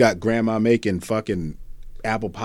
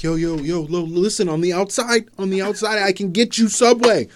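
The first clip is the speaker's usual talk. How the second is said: high, pleading, louder; pleading prisoner voice; 9 semitones higher, 7 dB louder than usual. Pretend. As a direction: beg for your life, high-pitched. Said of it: "He's in there like".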